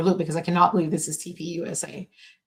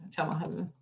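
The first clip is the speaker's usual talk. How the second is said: hushed and much softer, almost no voice; real whisper; 12 dB quieter than usual. Real, not acted. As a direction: whisper so the upset dog isn't stirred up. Dog barking, husband calming her.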